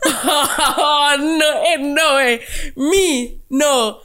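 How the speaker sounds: mock outrage. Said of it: "Purely halal".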